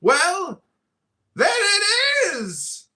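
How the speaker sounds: high, long-drawn theatrical yell; mocking shout. Companion throwing a pillow at sleeper, drawn-out shout. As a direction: holler it, stretching the last word. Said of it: "said the other, laughing".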